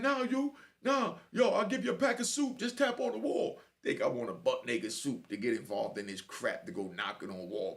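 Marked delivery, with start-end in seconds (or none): 0.0-3.6 neighbour pleading. Retold or imitated. Neighbour pleading through the wall.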